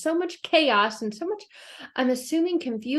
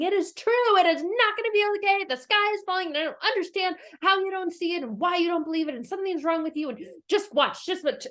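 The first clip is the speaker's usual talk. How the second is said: higher, certain panicky insisting; insistent Chicken Little; 8 semitones higher than usual. Retold or imitated. Chicken Little insisting the sky falls, high stubborn panic. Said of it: "believed with every ounce of her being".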